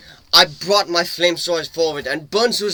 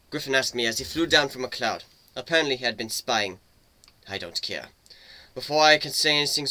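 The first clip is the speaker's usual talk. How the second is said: plain reading voice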